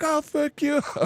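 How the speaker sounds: shrill it back at him in mock fury; woman pushing back. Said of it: "And she was like".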